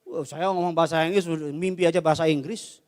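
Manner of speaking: usual speaking voice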